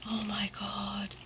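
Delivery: slow, hushed whisper of real amazement; whispered in disbelief; stunned whisper. Real, not acted. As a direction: breathe it out softly, awestruck. Stunned by the gift.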